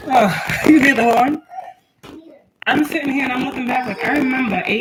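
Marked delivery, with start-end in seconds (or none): 0.1-1.4 amused pointing out. Real, not acted.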